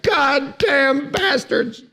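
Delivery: frustrated curse. Retold or imitated. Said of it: "You're like".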